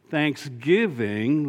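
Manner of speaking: "thanksgiving" drawn out slowly with a rising end; stressed word. Shown as a demonstration. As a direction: lean on "thanksgiving", stretch it. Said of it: "I emphasized that word".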